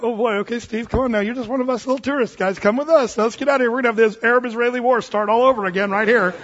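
nervous coaxing. Retold or imitated. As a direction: tug him away from the fight, anxious. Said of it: "we were kinda like".

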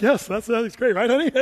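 a light put-on voice of a husband cheering to his wife; mock eager husband. Preacher coaxing the crowd to agree, lightly voicing a husband. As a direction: play the eager husband nudging his honey. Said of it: "You should be like".

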